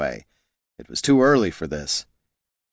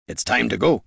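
gruff dwarf voice; 4 semitones higher than usual. Acted. Gorm urges the ranger.

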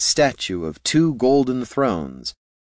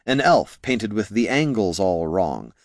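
usual speaking voice

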